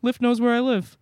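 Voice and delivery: high mock-cheery voice; mock cheerful. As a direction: sing it out like a naive fan. Mocking naive users.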